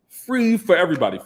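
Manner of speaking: free hit high and loud after a little laugh; amused, emphatic lift on the word free; emphasis on free. Real, not acted. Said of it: "emphasis on free".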